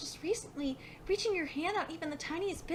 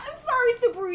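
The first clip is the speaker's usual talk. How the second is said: loud, high mock apology; gloating outburst; 8 semitones higher, 8 dB louder, much faster than usual. Real, not acted. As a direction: burst out in triumph. Gloating at a losing character.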